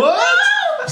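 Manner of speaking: high shocked yelp of what; startled what. Retold or imitated. Kid in the back seat hearing grandma wants to talk, shocked high cry. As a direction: jump out of your skin at the idea.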